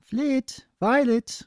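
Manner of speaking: high calling voice of a parent. Acted. Mother calling her foals home, high and carrying. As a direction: call the foals home from across the field.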